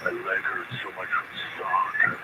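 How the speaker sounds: soft breathy mock moan about his leg; mocking complaint. Cohost mocking his partner's morning complaints, soft and low. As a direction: parody the cohost's daily moaning. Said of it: "Every morning you come in".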